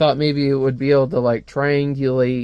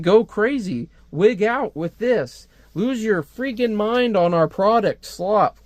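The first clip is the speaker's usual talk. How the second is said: higher-pitched parody of hype slogans; mock ad pitch; 6 semitones higher, slower than usual. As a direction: push the product like a manic advertiser. Mocking manic advertising slogans.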